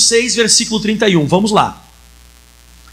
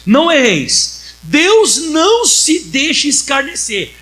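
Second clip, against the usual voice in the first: slow, louder, drawn-out Bible verse; solemn scripture reading; 4 semitones higher, 4 dB louder, much slower than usual. Acted. Preacher reading Jesus's words to the congregation, slow and loud. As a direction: proclaim each word of the verse slowly.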